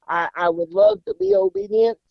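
high, sweetly fake-obedient child; mock polite kid. Boy in tree tells mom he'd love to obey, mock sweet. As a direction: pretend to be the dutiful son, high and sugary.

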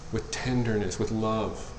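tender. Real, not acted. Pastor showing church how to speak, tender and soft. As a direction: ease down into tenderness. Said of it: "say it with tenderness".

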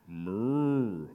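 long low moo; drawn-out low cow noise. A narrator's reading. Animals scramble into truck.

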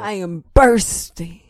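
"bursting" drawn out with a full hard R; enunciated R. Shown as a demonstration. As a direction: say "bursting" slowly, leaning on the R. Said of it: "enunciation of the R".

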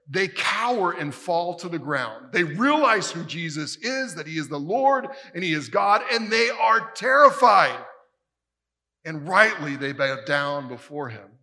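loud, rising preacher's fervor; preaching at full force. Acted. Preacher tells the congregation of the terrified disciples at full force. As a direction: drive it home to the congregation.

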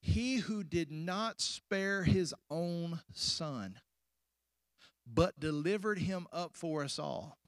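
slow, deliberate scripture reading with long pauses. Shown as a demonstration. Preacher reads verse thirty-two to the church, slowly. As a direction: read the verse out slowly, phrase by phrase. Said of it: "I want you to read it slow".

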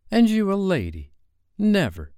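high-pitched indignant protest; firm refusal. A narrator's reading. Peter refusing to let Wendy draw lots, indignant.

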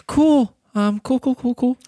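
tense, forced casual "cool"; trying to sound relaxed but tense; nervous. Acted. Nervous NPC voiced by DM.

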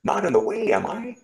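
high worried question; anxious road sweeper. Acted. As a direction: check you are not a bother.

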